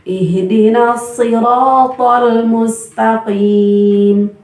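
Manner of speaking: slow chant, siratal with a heavy so and no pouted lips; correct heavy so. Shown as a demonstration. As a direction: siratal dibaca tebal tanpa manyun. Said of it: "bibirnya tidak manyun". (pengucapan so yang tebal)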